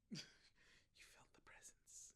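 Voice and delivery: very hushed, voiceless whisper; mock eerie whisper. Friend interjects as the storyteller describes being stared at, eerie whisper. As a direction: whisper it like a spooky aside.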